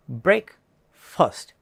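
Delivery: ബ്രേക്ക്ഫാസ്റ്റ് said slowly as BREK-fust, not stretched; slow BREK-fust, short second part; correct breakfast. Shown as a demonstration. Teacher models the right breakfast. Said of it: "correct pronunciation".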